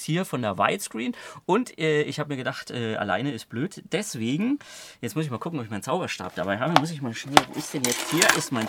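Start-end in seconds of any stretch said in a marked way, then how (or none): none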